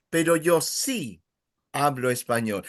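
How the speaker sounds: a short pause after "sí" before "hablo español". Shown as a demonstration. Teacher modeling the pause for the class, pause after "sí". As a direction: stop briefly after "sí", then go on. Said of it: "you would even give that little pause".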